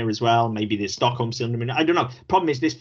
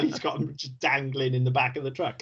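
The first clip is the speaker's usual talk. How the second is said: usual speaking voice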